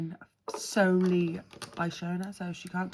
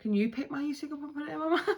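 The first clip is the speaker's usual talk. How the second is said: laughing; 7 semitones higher, much faster than usual. Real, not acted. Laughing request, mouth busy.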